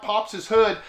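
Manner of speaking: usual speaking voice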